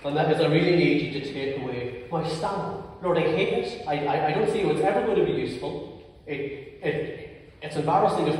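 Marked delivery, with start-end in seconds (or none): none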